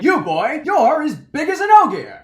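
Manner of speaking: loud, high-pitched theatrical pronouncement; imperious gleeman. Retold or imitated. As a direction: call out the boy grandly. Gleeman loudly addresses Perrin.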